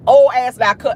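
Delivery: usual speaking voice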